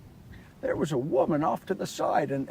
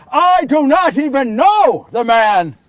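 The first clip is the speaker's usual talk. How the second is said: loud, desperate shout of denial; 4 semitones higher, 10 dB louder than usual. Acted. Peter shouting at the man who recognizes him: loud denial. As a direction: yell it to shut him up. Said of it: "And I shouted at him".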